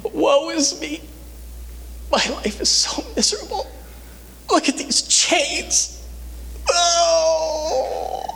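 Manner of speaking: high, whiny, mock-miserable wail; mock self-pity. Paul pictured moaning in prison, hammed-up self-pity. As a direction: whimper like a sorry prisoner.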